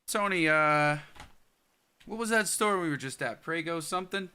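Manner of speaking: calling out loud and high. Real, not acted. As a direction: shout across to Tony. Calling to Tony.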